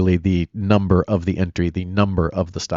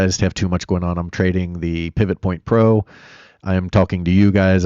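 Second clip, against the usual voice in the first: ordinary delivery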